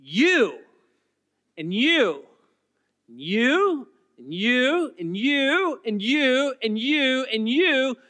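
each "you" hit loud and high, pointing round the room; insistent "you" repeated, rising and ringing; emphatic you. Shown as a demonstration. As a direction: stab every "you" after a pause. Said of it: "It's an emphatic you".

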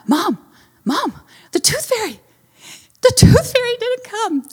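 high-pitched upset little boy, hammed up; crying child imitation. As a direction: mimic a distraught kid calling for mom. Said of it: "He's crying".

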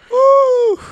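high loud whoop; cheer. Real, not acted. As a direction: whoop in delight. Cheer after confession.

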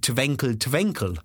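"Twinkle Twinkle" as slow Russian "tvenkle"; Russian accent. Retold or imitated. Parent back from Kiev at bedtime, mock Russian accent. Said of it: "Russian references".

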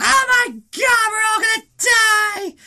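high, slow, shrieking panic; a high, drawn-out shriek of doom; panicked scream. Retold or imitated. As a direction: scream it like the building is falling in on you. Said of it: "all of a sudden they're like".

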